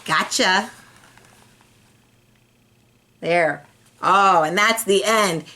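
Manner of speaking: plain reading voice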